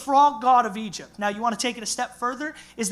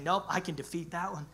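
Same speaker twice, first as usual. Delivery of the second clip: God's confident voice; 3 semitones lower, 7 dB quieter than usual. Retold or imitated.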